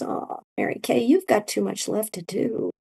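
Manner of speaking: hushed, almost whispered kindly; soft gentle voice. Retold or imitated. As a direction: gently break the news. Friend gently sending her back.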